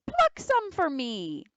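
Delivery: begging. Acted. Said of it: "the wolf begged".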